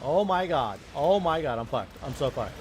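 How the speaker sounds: loud panicked outburst. Real, not acted. Sudden panic in the game.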